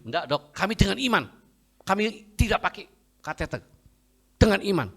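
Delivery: high, emphatic rebuttal; insistent refusal. Retold or imitated. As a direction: stand firm on faith against the doctor. Acted insistent refusal to doctor.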